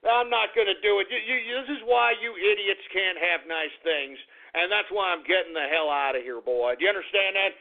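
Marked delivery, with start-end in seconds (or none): none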